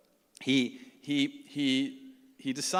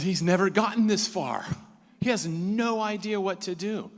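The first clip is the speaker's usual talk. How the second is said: raised, tense; 6 semitones higher, 7 dB louder than usual. Real, not acted. Tense climax of the story.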